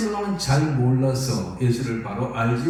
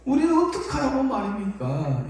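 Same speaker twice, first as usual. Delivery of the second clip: pleading believer; 9 semitones higher than usual. Retold or imitated. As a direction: beg him not to die. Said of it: "이거거든요".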